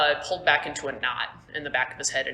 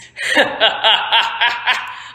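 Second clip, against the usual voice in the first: loud, raised staccato cackle; hammed-up villain's ha-ha-ha; villainous laugh; 5 semitones higher, 6 dB louder than usual. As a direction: laugh in gloating triumph. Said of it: "and he's laughing".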